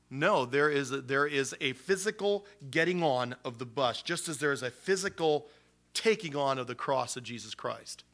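ordinary talk, nothing marked

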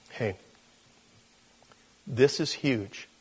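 usual speaking voice

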